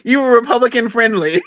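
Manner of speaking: high, laughing jab; laughing tease. Real, not acted. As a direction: tease her through real laughter. Teasing with her own term.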